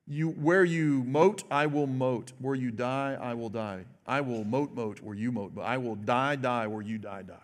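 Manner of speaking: softening, sinking Ruth's vow to a hush; tender vow. Retold or imitated. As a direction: let the promise fade to almost nothing. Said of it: "what Ruth says to Naomi".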